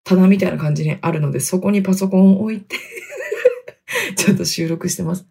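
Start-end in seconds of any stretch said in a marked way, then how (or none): 2.8-4.1 soft laugh. Real, not acted. Laughs about recording in closet.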